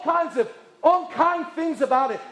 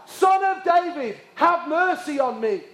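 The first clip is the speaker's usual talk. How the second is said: raised preacher's cry, easing toward the end; shouted plea; 4 semitones higher, 3 dB louder than usual. Acted. Blind Bartimaeus calls to Jesus over the crowd, shouting. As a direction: call out high, pleading for mercy. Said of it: "he began to shout".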